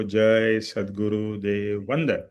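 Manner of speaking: "जय सद्गुरुदेव वंदन" drawn out slowly, word by word on a level tone; spoken in full. Shown as a demonstration. Teacher shows listeners the full greeting, "जय सद्गुरुदेव वंदन" slow and complete. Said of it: "पूरा बोलना".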